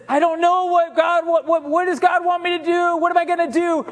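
high, frantic king voice; panicked Saul. Acted. Saul begging for answers, high and frantic. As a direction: pitch it up like a desperate king.

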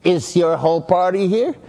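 mock snippy hostess, tone tilted up on "here"; mean hostess. Preacher retelling the hostess, a mild mean sneer. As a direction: sneer the question without raising the voice. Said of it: "And she said it like mean".

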